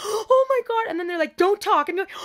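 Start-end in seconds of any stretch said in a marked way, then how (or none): 0.0-0.9 gasping high, loud cry; gasp, then cry out high as if hurt; acted pain. Cuffs slammed on the wrists, gasping pained cry. 1.4-1.9 loud, curt command; mock stern order. Police to handcuffed woman, loud mock order. Voicing police silencing her.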